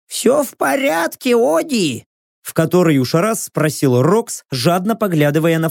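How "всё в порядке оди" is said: high-pitched companion voice; Rox. A narrator's reading. Rox asks Odi if all is well, high character voice. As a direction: check on a friend in a high character voice. Рокс checks on the narrator.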